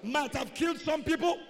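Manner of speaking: high, loud drawn-out declaration; shouted church proclamation, slow on the big phrases; full-force preaching. Real, not acted. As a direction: preach it out loud for the congregation to repeat. Leads congregation in declaration.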